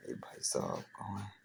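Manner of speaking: a hushed word to someone nearby; breathy whispered aside to a helper. Real, not acted. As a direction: whisper it off to the side, almost no voice.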